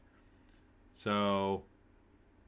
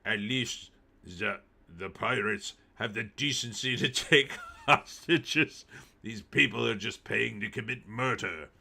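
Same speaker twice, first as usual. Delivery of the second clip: bad Russian accent. Streamer reading a yachtsman's quote to chat in a weak Russian accent. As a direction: read the quote in a half-hearted Russian accent. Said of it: "that wasn't a very good Russian accent".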